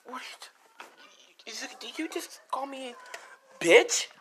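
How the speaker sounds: drawn-out whisper rising to a high, loud last word; acted offended shock. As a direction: build from stunned whisper to shrieked question. Rose reacts to being insulted.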